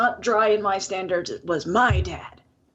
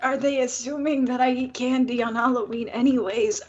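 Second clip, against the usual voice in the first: ordinary talk, nothing marked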